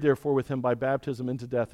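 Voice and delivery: ordinary delivery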